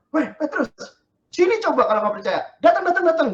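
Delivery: playful laughing dare to Peter; playful beckoning Jesus. Pretend. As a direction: tease Peter to come over, laughing.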